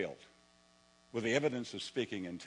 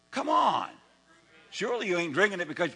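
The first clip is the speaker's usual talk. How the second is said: high, loud mock disbelief; incredulous; 5 semitones higher, 7 dB louder than usual. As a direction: scoff at the idea. Preacher challenging congregation.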